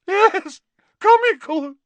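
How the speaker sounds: very high, loud theatrical outburst; hysterical. A narrator's reading. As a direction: shriek it in wounded disbelief. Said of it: "Her voice rose rather hysterically".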